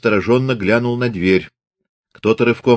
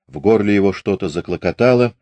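plain reading voice

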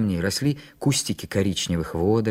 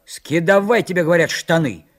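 raised, higher, commanding voice; angry order; 5 semitones higher, 5 dB louder than usual. A narrator's reading. Санька orders Митька to strip, angry raised voice. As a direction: order it loudly, tolerating no refusal. Boy angrily orders his friend.

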